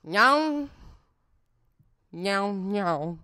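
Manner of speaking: lightly mocked actress delivering a monologue; garbled, drawn-out mock of an actress; mock a feeble dramatic monologue in meows; Kristen Stewart. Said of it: "she just was like".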